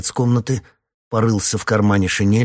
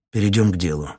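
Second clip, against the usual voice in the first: plain reading voice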